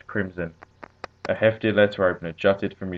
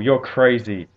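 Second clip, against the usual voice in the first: loud, higher-pitched outburst; angry, raised-voice accusation; shouted; 4 semitones higher, 6 dB louder, slower than usual. A narrator's reading. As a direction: shout it as an interruption. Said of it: "Sloan shouted".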